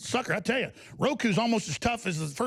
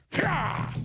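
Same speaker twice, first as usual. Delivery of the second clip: a raised, victorious Yeah! after the smash; triumphant yell; 7 semitones higher than usual. Real, not acted. Host cheering as the Roku finally breaks, triumphant yell. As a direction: cheer the hit like you scored a goal.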